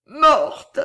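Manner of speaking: high, loud cry of grief; tearful cry. A narrator's reading. Weeping child over the corpse, high stricken cry. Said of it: "répéta l'enfant, dont les larmes tombaient".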